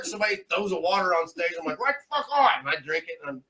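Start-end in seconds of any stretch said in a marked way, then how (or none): 1.8-2.5 high, loud cheer; elated thanks. Retold or imitated. Comic to audience member who threw water, loud high cheer. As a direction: cheer it out, much higher.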